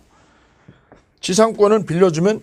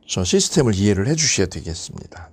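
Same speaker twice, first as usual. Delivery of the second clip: ordinary delivery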